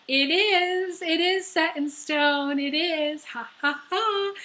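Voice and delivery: smug high-pitched fate taunt; mocking fate voice. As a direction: gloat like fate itself, then laugh. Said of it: "Fate goes".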